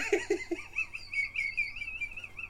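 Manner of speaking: extremely high, squealing laugh; helpless soft squeal of laughter; dog-frequency laugh. Real, not acted. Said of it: "I'm reaching dog frequencies here".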